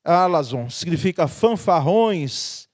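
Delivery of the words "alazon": alazon with a strong first A; stress on first syllable. Shown as a demonstration. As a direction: say "alazon" as A-la-zon.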